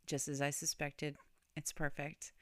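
low, hushed imitation of a friend; understated quoted verdict.

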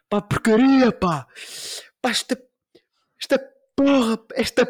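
high, loud outburst that stalls before porra; mock angry hesitant curse. S0 showing how he swears at home: loud, stalling mock outburst. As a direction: play holding back the swear word.

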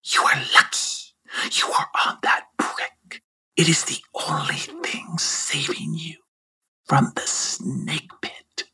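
low whispered serpent threat; hissing snake whisper. Acted. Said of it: "and finally said".

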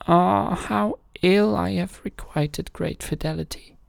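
high, rueful lament; sorrowful king voice. A narrator's reading. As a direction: regret a grave wrong.

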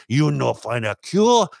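hammed-up mock accent; mock Chinese accent. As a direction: scoff back at Trump in a put-on accent. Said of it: "you could tell the whole world".

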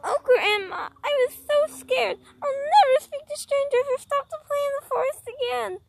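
put-on high, loud, tearful child voice; girl clinging to grandma after the scare; mock frightened girl. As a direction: wail it like a scared little girl. Girl shaken after rescue.

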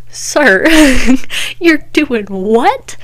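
slow, loud, laughing disbelief; incredulous outrage. Retold or imitated. To the neighbor's dad about his saying, slow laughing disbelief. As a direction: demand it through a laugh.

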